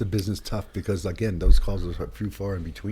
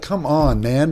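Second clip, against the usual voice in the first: exasperated; 5 semitones higher, 6 dB louder, much slower than usual. Retold or imitated. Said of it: "you're like".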